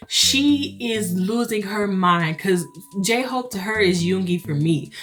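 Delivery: ordinary talk, nothing marked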